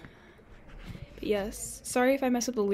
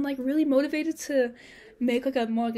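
ordinary talk

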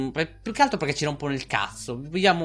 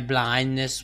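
normal talking voice

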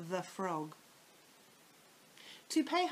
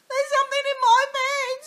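put-on high, loud terrified old woman; panicked scream; 16 dB louder than usual. A narrator's reading. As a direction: shriek it out in panic. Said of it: "screamed Missus Twit".

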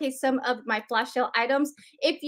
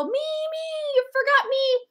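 much higher, playful pleading; mock whiny viewer; 11 semitones higher than usual. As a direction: whine it like a left-out fan, jokingly. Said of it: "please go".

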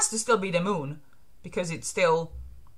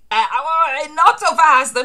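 alarmed protest; 7 semitones higher, 8 dB louder, much slower than usual. Acted. Villain halting an attacker.

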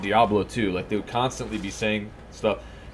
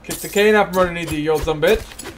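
raised, higher, quick mock jeer; playful combat taunt; 6 semitones higher, 5 dB louder, faster than usual. Gamer attacking an old man in game, raised mock taunt. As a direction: rattle off a playful loud taunt.

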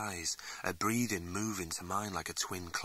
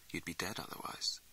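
softer whispered warning; quiet warning whisper; 3 semitones lower, 8 dB quieter than usual. Acted. Game Cat warning the young man, quiet whisper. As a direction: whisper the danger close.

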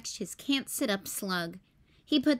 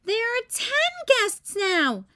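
high, louder, slow sister voice; exasperated Ruby; 12 semitones higher, 5 dB louder, slower than usual. A narrator's reading. Ruby tells Max the guests keep multiplying, exasperated. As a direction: announce it with big-sister exasperation. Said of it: "said Ruby".

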